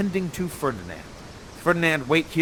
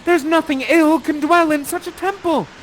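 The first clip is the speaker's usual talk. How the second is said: much higher and louder; Miranda pleading; 11 semitones higher, 10 dB louder than usual. Acted. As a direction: defend him to her father. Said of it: "She stands in between ... and says".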